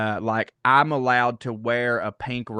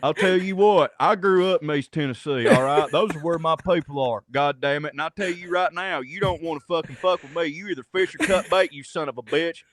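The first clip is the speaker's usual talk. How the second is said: Doctor Phil with a busted nose, tough-guy Tennessee swagger; Trey Crowder impression; 2 semitones higher than usual. Shown as a demonstration. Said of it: "had a severe sinus attack or got punched in the nose".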